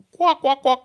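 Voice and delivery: high, loud silly quacks; goofy quacking punchline; mock duck quacking. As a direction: quack like a cartoon duck, hammed up. Jokes about taking chicken way.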